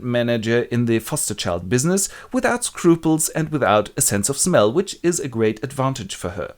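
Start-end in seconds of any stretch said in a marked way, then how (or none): none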